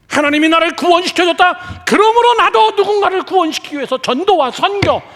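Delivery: high, loud, urgent declaration; full-force preaching. Real, not acted. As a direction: proclaim it to the whole congregation.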